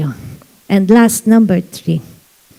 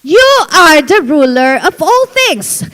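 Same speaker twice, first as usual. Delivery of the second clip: fiery preaching; 9 semitones higher than usual. Acted. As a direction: preach it out to the whole church. Preaching at full force. (pastor to congregation, nag-aalab na pangangaral)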